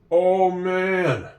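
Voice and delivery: usual speaking voice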